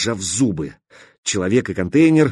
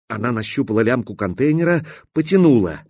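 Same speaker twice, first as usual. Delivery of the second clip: ordinary delivery, nothing marked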